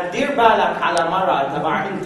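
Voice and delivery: put-on low, slow Turkish-accented taunt; broken Arabic taunt. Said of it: "الشنب ... يغيظ فينا".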